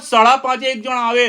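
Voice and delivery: ordinary talk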